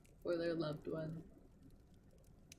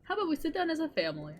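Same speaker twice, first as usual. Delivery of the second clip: exasperated calm-down; 9 semitones higher, 6 dB louder, much faster than usual. Retold or imitated. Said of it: "And Tighnari's just".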